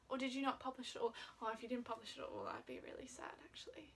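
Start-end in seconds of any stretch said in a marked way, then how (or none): none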